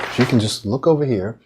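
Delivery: usual speaking voice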